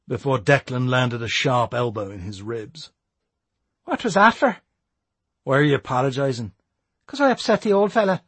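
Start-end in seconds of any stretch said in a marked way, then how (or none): 3.8-8.2 put-on young Irish lad voice. Matty's voice.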